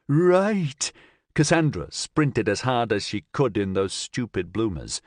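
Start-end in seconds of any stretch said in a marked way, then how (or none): 0.1-0.9 high, loud, sharp cry of "go"; panicked young woman. Acted. Cassandra to Nigel, panicked high yelp before fleeing.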